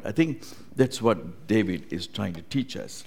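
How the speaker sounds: ordinary talk, nothing marked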